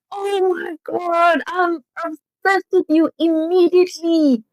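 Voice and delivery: high, slow, stretched-out gushing; gushing fangirl. Real, not acted. Fan gushing over Sarah in the store, excited. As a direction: swoon over them, drawing out every word.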